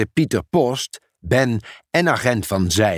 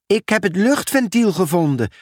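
higher-pitched, lightly called discovery; 8 semitones higher than usual. A narrator's reading. Lissy has found the air valve, calling it out. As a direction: call out the find, pitch well up. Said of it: "riep Lissy".

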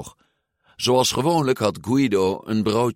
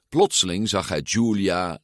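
ordinary delivery

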